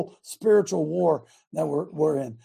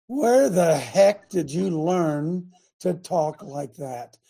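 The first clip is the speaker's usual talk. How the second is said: slow, drawn-out question, dropping on the last word; slow amazement; 2 semitones lower, much slower than usual. Real, not acted. Host marvelling at young guest's speech, slow amazement. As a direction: stretch out each word in astonishment.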